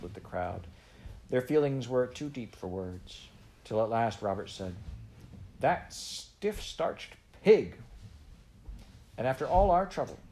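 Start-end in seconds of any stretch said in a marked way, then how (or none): 5.6-10.2 high, loud, very slow outburst; boy fuming over the stallholder; fuming insult. A narrator's reading. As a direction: spit out the insult in boyish outrage.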